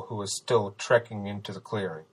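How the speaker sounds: plain reading voice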